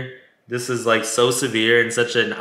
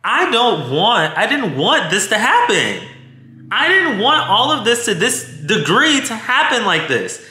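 higher-pitched, upset protest; emotional; 8 semitones higher than usual. Retold or imitated. Woman reliving her partner's arrest on his birthday, emotional. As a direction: plead with rising distress in the voice. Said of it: "I got all emotional because I'm like".

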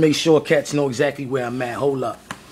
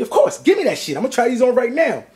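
excited burst; 7 semitones higher than usual. Real, not acted. To the store clerk offering the shoes, an excited yelp.

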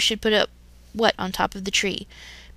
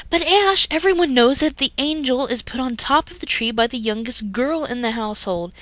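high, bright insistent protest; girl arguing with Ash over the tree, exclaiming; 4 semitones higher than usual. Acted. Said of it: "Misty exclaimed".

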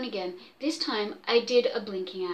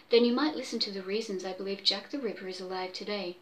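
hurried; 3 semitones lower, 4 dB quieter, faster than usual. Acted.

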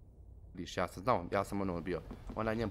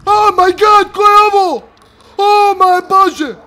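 high, loud panicked shrieks; frightened screaming; 13 semitones higher, 19 dB louder, slower than usual. Real, not acted. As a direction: scream in real terror. Horror game jump scare.

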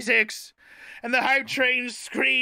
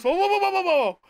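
startled whoa; 7 semitones higher, faster than usual. Retold or imitated. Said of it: "the Wild Hunt goes".

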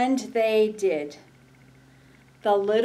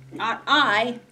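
quacking duck voice; 3 semitones higher, 5 dB louder than usual. Acted. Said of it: "quacked the duck".

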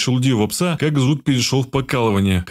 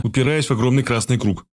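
ordinary delivery

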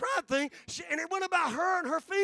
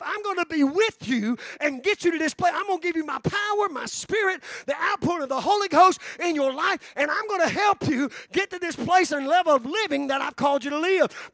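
louder, driving sermon delivery with rising force; fervent preaching; 3 semitones higher, 7 dB louder than usual. Acted. Preacher voicing God's promise to the church, loud fervent preaching. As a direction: lift it up, preach the promise with fire.

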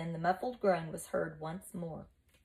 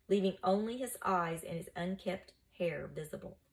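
ordinary delivery, nothing marked

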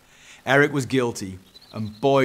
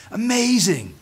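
high, loud marvelling; amazed; 11 semitones higher, 5 dB louder than usual. Acted. Eric hears about grace from Dad, amazed.

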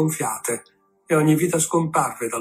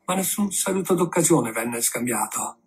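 plain reading voice

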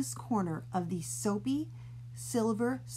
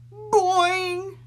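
high loud cartoon boing; springy boing; 10 semitones higher, 8 dB louder than usual. Reader making the hair spring back up. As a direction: bounce the sound like a spring.

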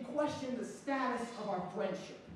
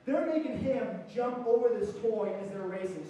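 normal talking voice

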